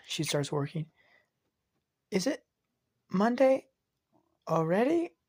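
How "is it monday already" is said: put-on high, surprised child's question; young child. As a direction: sound like a little girl checking the day. Child asking about the day.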